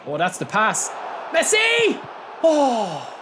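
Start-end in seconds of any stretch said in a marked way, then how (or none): 1.3-3.1 high, loud shout of the name; excited shout. Real, not acted. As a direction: shout as the shot goes in. Chance in match.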